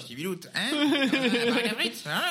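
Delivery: very high, giggling ch'ti caricature; goblin-like ch'ti. Acted. Clowning a northerner for laughing friends, goblin-like ch'ti voice. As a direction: squeak it like a little goblin from the North. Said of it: "Ils parlent comme des gobelins".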